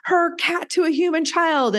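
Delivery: high-pitched, indignant imitation of angry readers; mock outrage. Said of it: "because they were like".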